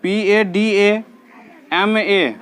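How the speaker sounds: slow, separated letters for the class; teacher spelling letters slowly. Shown as a demonstration. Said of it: "इधर ध्यान दो".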